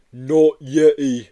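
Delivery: slow, loud, sad creature voice; a mournful monster correcting him; sad Yeti. Acted. Yeti at the window to Joe, slow and sad. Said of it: "replied the sad furry face".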